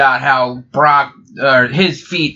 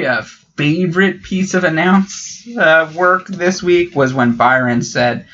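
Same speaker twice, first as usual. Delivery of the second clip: ordinary delivery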